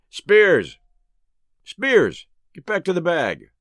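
sharp call. A narrator's reading. Umpire ordering Spears back to the bag, called out sharply. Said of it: "Umpire Bates called out sharply".

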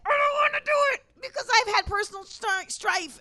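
high mock protest; whiny objector. Mimics an excuse about privilege.

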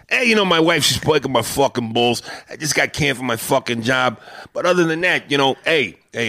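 a lightly voiced Italian-American uncle giving advice; Italian-American accent. Host riffing as an Italian uncle, light Italian-American accent. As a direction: chat it up like a friendly wise guy. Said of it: "this Italian character".

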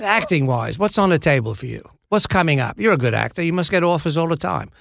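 casual, hammy Cockney impression; imitated London star, chatty; Michael Caine. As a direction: coax a fellow actor in rough Cockney. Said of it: "This is a terrible Michael Caine impression".

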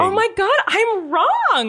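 high, stunned admission; shocked realization. Real, not acted. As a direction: blurt it out in sudden shock. Realizes her mistake.

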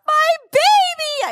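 high, loud, drawn-out wail; dramatic blurted cry. Retold or imitated. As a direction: blurt it out like a melodramatic mother. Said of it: "I just blurted out of my mouth. So dramatic".

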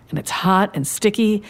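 usual speaking voice

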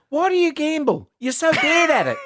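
high-pitched exasperated partner impression; mock scolding in a high, put-on voice; Cherie. Man imitating his partner about his gambling, high and exasperated. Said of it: "She goes".

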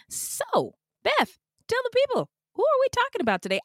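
high, fast sing-song host voice; playful high voice. Pretend. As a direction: ham up the cue to your co-host. Hosts' bit, playful high voice.

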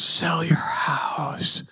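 slow, eerie whispered voice; ghostly whisper. Retold or imitated. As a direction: whisper it like a voice from nowhere. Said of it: "The voice goes".

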